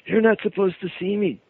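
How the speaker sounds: high, panicky little creature voice; frightened small creature caught out; scared creature. Retold or imitated. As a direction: squeak it out like a startled imp. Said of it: "he's shaking, he's trembling".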